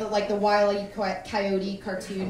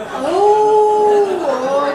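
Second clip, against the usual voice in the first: loud high "oh" of getting it; sudden realisation; 10 semitones higher, 18 dB louder than usual. Real, not acted. Comic getting her mom's joke, loud realisation. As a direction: let the penny drop loudly.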